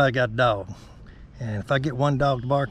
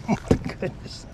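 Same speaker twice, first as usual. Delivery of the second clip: breathless whisper, pitched up; higher delighted whisper; awed whisper; 8 semitones higher, 6 dB quieter than usual. Real, not acted. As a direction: gasp it out in a thrilled hush. Delight at big crappie.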